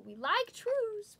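high, slow put-on voice; silly voice. Breaker joking to viewers about trues, goofy high slow voice.

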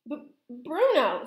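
high, loud, slow stammer. A narrator's reading. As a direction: stumble on the name in shock. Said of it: "stammered Mister Jenkins".

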